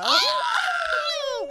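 very high, loud shriek of shock; delighted scandalized scream, breaking into laughter; real high-pitched whoop of disbelief; shocked squeal. Real, not acted. Shocked at the trashy remark.

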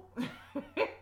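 soft, breathy run of chuckles. Real, not acted. Preacher admitting she cannot change her future, soft chuckle. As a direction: laugh quietly at yourself. Laughing at her own helplessness.

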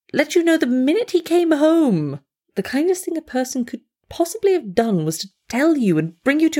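a busybody friend who scolds and coddles by turns; fussing friend. Acted. Friend fussing over heartbroken Mona at her bedside, scolding then soothing. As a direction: chatter on, half scolding, half soothing. Radio play monologue.

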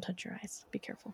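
soft whispered caution; whispering. Real, not acted. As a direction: breathe the warning gently. Quiet warning aside.

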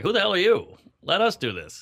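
high, indignant retort to the director; mock defiance. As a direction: talk back to the camp director. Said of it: "I'm like".

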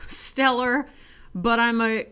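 ordinary talk

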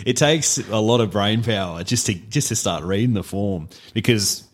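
normal talking voice

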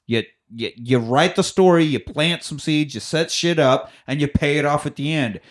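normal talking voice

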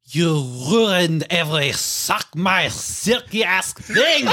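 loud, slow, hammed-up French accent; over-the-top French impression, loud and high. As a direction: ham up a French accent, stretching it out and rising. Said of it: "give me your best French impression".

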